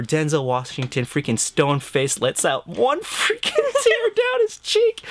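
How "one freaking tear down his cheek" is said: choking up. Real, not acted. Host describing Denzel's tear, choking up himself. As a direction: voice cracks high, half laugh half cry. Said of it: "I know, I'm tearing up".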